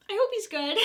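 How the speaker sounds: real tearful break on the words. Real, not acted. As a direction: let the voice crack as hope slips out. Missing the lost dog, tearful.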